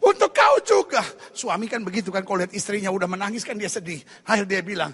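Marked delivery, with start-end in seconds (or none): 0.0-1.0 hammed-up weepy protest; crying wife. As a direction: plead through mock sobs, rising as it goes. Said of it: "istrinya langsung menangis".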